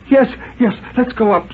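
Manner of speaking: jumpy woman hurrying upstairs in fear; frightened Mrs Carter. Acted.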